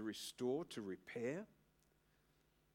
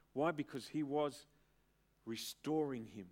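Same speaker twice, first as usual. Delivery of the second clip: ordinary delivery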